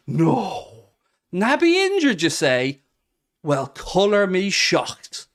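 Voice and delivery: feigned disbelief with exaggerated emphasis; sarcastic mock shock. Streamer mocks Naby Keita's latest injury, in sarcastic shock. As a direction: act scandalised by thoroughly predictable news. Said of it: "the shithouse in me coming out".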